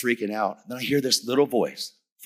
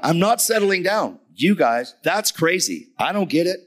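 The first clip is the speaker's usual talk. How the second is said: high, agitated outburst; retold freaking out; 8 semitones higher, 5 dB louder than usual. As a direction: refuse to calm down, pitched way up. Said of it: "Brother, settle down".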